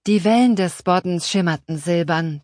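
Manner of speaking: usual speaking voice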